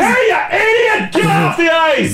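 high, slow yelling guard; alarm shout. Pretend. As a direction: bark at someone walking on the ice. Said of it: "you need a security guard yelling".

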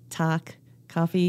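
"talk, coffee" with open Scranton "ah" vowels; Scranton accent. Shown as a demonstration. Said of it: "the Scranton accent".

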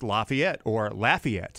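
"Lafayette" first with LAH, then with flat LAFF; two Lafayette pronunciations. Shown as a demonstration. Host names the town they broadcast to, contrasting pronunciations. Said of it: "but that's French".

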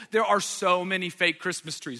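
ordinary delivery

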